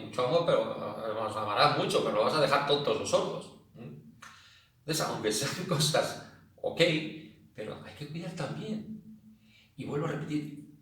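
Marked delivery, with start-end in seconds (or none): none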